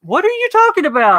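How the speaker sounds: high, puzzled disbelief; what on earth are you saying; baffled. Real, not acted. Baffled at an odd question.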